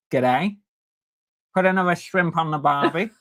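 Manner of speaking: put-on Aussie greeting; hammed-up Australian; mock Australian accent.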